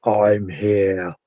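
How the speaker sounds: low, soft imitation of a deep voice. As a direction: drop the pitch and volume, imitating a deep voice. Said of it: "the really deep voice saying".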